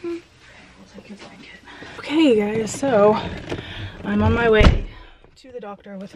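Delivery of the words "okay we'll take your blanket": very soft whisper; hush, speak barely aloud. Real, not acted. Whispering to sick child.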